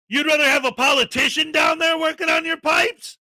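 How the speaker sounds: mock disbelief, voice up high; incredulous rant. Real, not acted. Ranting at frauditors, loud and incredulous.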